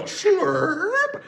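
high loud slurping sound; slurp noise. Retold or imitated. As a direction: slurp like sucking a lemon. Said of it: "he'd go".

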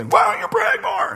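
mock shaming spouse scold, high and loud; shaming nag. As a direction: nag them mockingly in a shrill high voice. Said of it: "go to the shame".

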